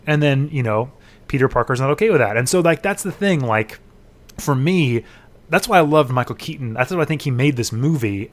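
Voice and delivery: usual speaking voice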